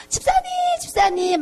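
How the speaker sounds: high, excited cry of her name; excited calling out. Retold or imitated. Friend on the phone calling out high and excited. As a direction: call out in sudden joy.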